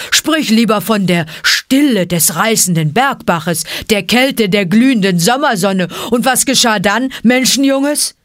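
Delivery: mock the boy's foolish story; scornful bear. Bear scolds the man-cub with scorn.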